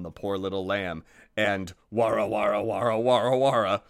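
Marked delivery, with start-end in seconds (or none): 1.9-3.8 gobbling noise. Acted. Wolf devouring noise.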